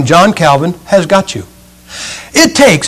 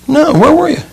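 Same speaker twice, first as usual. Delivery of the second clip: much higher, curious acted question; high-pitched concern; 10 semitones higher than usual. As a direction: ask with a high lilt.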